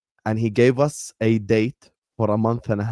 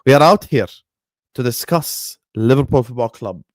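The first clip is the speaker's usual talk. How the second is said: ordinary talk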